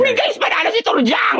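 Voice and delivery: high excited call to the friends; excited cheer. Acted. Friend announcing Turjang's win to the group, high and excited.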